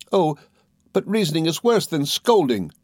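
high-pitched wifely dismay; despairing exclamation. A narrator's reading. Dora dreading being reasoned with, exclaiming in despair. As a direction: wail it lightly, in despair. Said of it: "exclaimed Dora in despair".

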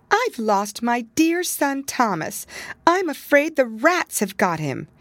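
a worried mother cat fretting over her lost son; tearful mother cat. A narrator's reading. Mother cat tells her cousin her son is lost, mildly tearful. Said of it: "She wiped her eyes with her apron".

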